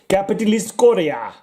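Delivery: a bad go-to Russian accent, played for laughs. Vlogger reading a comic cover in a put-on Russian accent. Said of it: "that was my go-to Russian accent".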